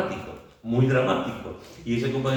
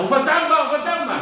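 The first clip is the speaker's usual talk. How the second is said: high, loud cry of panic; shouting across a stormy sea; terrified shout; 7 dB louder than usual. Retold or imitated. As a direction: scream it out in fright. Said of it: "esto tuvo que ser a grito pelado".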